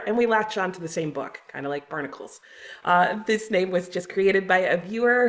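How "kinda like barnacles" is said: ordinary delivery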